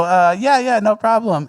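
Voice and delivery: high, loud, obliging quoted reply; restaurant worker. Retold or imitated. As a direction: pitch up and agree readily. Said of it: "And they would go".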